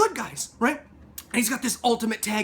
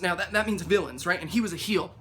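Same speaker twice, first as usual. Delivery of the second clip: ordinary delivery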